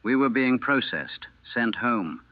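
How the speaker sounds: usual speaking voice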